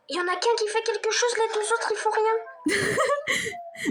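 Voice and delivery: high laughing complaint. Real, not acted. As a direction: squeal it while laughing.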